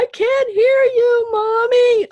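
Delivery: put-on children shouting they can't hear mom; kid yelling. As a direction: yell it like a frantic little kid. Kids yelling back at mom.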